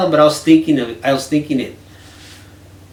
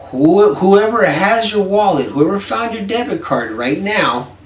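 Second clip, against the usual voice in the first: exasperated; 7 semitones higher, 6 dB louder, slower than usual. Retold or imitated. Mocking friend's careless hope.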